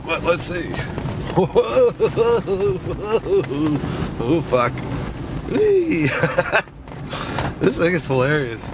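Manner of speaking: usual speaking voice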